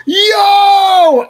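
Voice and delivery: high, long-held mock holler; hailing shout. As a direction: call out to a buddy across the store. Acts out man hailing him.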